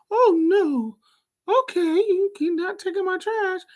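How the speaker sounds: high put-on voice mocking the lazy guy; mocking his refusal. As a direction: parrot him in a silly high voice.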